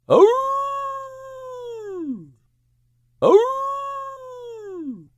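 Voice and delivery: long, very high howl, fading away; wolf howl. Acted. Wolves howl behind Max.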